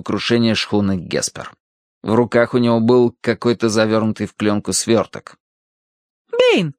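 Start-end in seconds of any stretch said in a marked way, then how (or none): 6.3-6.7 put-on very high girlish call; Tossie. A narrator's reading. Tossie summoning the butler, high-pitched call. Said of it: "позвала его Тосси".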